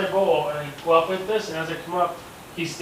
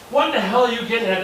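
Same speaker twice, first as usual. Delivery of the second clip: higher and louder, fed up with dad; exasperated outburst; 7 semitones higher, 5 dB louder, faster than usual. Retold or imitated. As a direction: snap back at dad, exasperated. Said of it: "I'm like".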